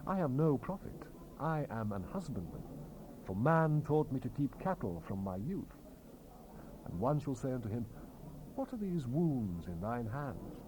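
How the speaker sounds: normal talking voice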